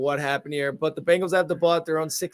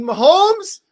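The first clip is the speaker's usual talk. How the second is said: excited call; 12 dB louder than usual. Acted. Calling the play excitedly.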